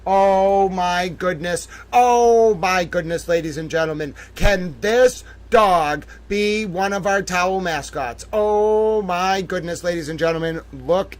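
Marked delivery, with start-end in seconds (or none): 0.1-3.3 swooning gush. Acted. Host overwhelmed by cute dog photo, slow gush. Gushing over dog picture. 8.3-9.8 swooning gush. Acted. Host overwhelmed by cute dog photo, slow gush. Gushing over dog picture.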